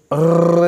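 अर्र as a long rolling trill; rolled R. Shown as a demonstration. Teacher shows a hard sound, long trilled अर्र. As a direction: sustain the rattle of the R in अर्र. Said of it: "ये निकालना आपको बहुत ही मुश्किल".

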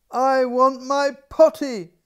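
high, loud demanding cry; child's loud high wail. A narrator's reading. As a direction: wail for the potty like a toddler. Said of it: "she cried".

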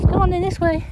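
high call to the pet; calling the dog. Real, not acted. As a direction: coax the dog along.

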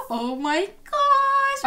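high gasp, then drawn-out squeal; gushing. Real, not acted. Gushing over cute child in game.

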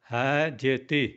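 ordinary delivery

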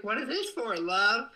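high-pitched mock mom voice; imagined mom's reaction. Streamer imagining friend's mom getting the gift, high imitation. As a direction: lift it high, imitating a puzzled mother. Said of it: "She's gonna be like".

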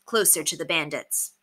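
usual speaking voice

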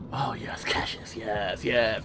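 soft greedy whisper; breathy covetous whisper. Pretend. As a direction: hush over the treasure like a miser. Whispering over loot.

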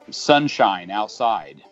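usual speaking voice

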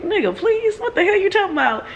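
high, incredulous put-down; sassy disbelieving comeback, way up high; Hedwig's disbelief. Retold or imitated. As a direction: scoff like an unimpressed owl. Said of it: "Hedwig looked at him like".

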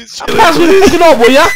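loud, slow, high drawn-out demand; drawn-out yell. Real, not acted. Egging a friend to do an accent, loud drawn-out yell. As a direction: stretch it out and yell it.

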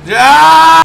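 loud, high triumphant yell; triumphant shout. Real, not acted. Victory in game.

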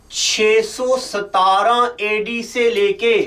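slow, spaced-out teacher dictation. Acted. Teacher dictating notes slowly to camera. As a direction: read it out slowly so they can write.

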